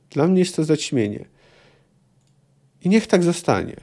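reading a novel aloud, steady and measured; measured literary reading; story narration. A narrator's reading. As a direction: tell it like an audiobook narrator. Reads dialogue passage.

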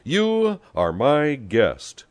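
high, loud, very slow insistence; drawn-out forceful declaration; emphatic claim. A narrator's reading. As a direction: stake your claim word by word. Rivals quarrel over who hosts him.

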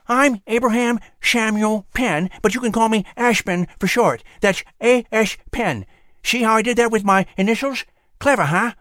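high squirrel voice with whistled esses; proud little squirrel, lisping through buck teeth; whistling through teeth. Acted. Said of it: "a flying squirrel with a speech impediment".

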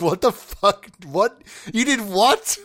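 incredulous, rising disbelief; retold shocked outburst. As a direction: react like you can't believe it. Shocked reaction to grandmother's theft.

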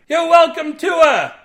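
high, loud distant shout; pirate shouting from the cruise ship; shouting across the water. Acted. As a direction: call it out to the other ship.

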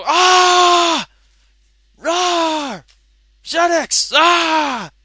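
high shouted monster roars; playful monster chase. Pretend. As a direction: roar like a cartoon monster. Monster roars at Radio Nintendo.

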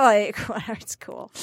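laid-back dude, fading to almost nothing; lightly voiced indifferent bro; real chill guy. Mimicking a date asked if he's hungry, real chill. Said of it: "Just real chill, like".

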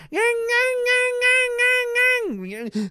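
very high whining dirt-bike revs; high-pitched motor noise made with the voice; imitated bike engine. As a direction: mimic a small bike revving over and over. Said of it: "he starts his bike".

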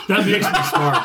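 giggling through the words; laughing. Real, not acted. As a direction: laugh while saying it. Laughing after joke.

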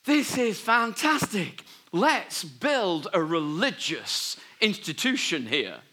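high, loud, slow gushing outburst; hammed-up Peter, thrilled with his own idea; excited Peter. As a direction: gush like an overeager disciple. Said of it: "Brilliant Peter says".

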